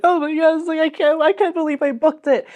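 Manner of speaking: high-pitched overwhelmed disbelief; mock tearful joy. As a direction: re-enact being overcome with happy tears. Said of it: "crying and, like, laughing".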